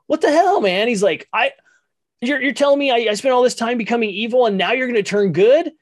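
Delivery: hammed-up indignant yell at the family; outraged Scott. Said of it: "he's just instantly pissed off".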